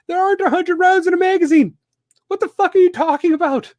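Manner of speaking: high, loud outraged rant. Real, not acted. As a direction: blow up in disbelief at him. Ranting at Biden.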